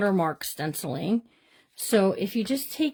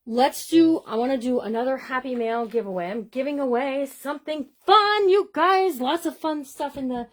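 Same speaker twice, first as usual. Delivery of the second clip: excited announcement; 5 semitones higher, 4 dB louder than usual. Real, not acted. Announcing a giveaway to viewers.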